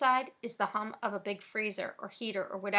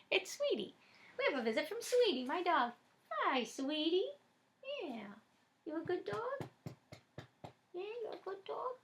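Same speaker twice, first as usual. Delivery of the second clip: doggy talk; 9 semitones higher, 6 dB quieter than usual. Real, not acted. Reader greets her dog.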